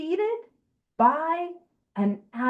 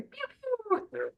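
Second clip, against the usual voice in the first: high, softer laser zaps; imitated pew-pew; 11 semitones higher, 9 dB quieter than usual. Speaker passing the turn to co-speaker, high soft pew-pew. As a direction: send out a quick high zap, softly. Playful sound while handing over.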